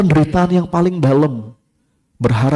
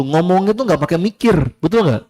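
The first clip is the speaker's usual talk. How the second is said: normal talking voice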